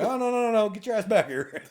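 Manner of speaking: hammed-up urgent call; mock call-out. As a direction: stop her leaving, half-laughing. Calling her back urgently.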